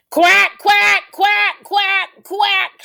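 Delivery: high, very loud quacks; shouting duck. Acted. Loud duck in the park, shouted quacking. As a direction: quack at full blast. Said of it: "shouts Dottie".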